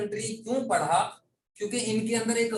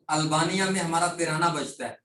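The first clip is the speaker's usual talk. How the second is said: normal talking voice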